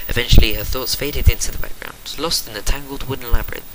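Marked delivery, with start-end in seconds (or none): none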